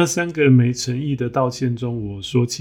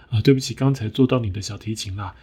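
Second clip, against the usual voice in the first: fake apology; faster than usual. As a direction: rush the sorry to get it over with. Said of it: "说得又小声又快".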